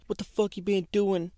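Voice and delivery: retold mom scream. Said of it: "she started screaming at me".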